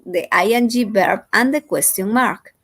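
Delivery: ordinary delivery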